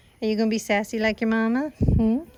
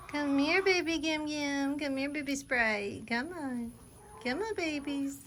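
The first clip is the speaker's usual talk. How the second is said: soft high coo; 6 semitones higher, 10 dB quieter than usual. Real, not acted. Owner softly calling her goats to come for brushing, hushed high coo.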